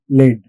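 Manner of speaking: "lead" said like "led"; "lead" as the metal, with a short e; short e pronunciation. Shown as a demonstration. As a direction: say "lead" to rhyme with "red". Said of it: "It rhymes with red".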